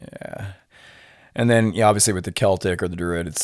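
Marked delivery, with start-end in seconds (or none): none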